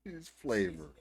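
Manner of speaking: a hint of her voice, teasing; mocking the vlogger. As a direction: echo her word in a lightly mocking tone. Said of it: "she called it flavor".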